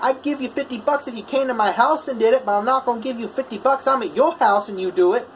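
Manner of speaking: high, argumentative imitation of a Boston-like customer; Boston-like accent. Imitated customer disputing welder's price, indignant Boston-like accent. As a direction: argue back in a mock northern, Boston-like accent. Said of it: "in the really thick northern, almost Boston-like accent".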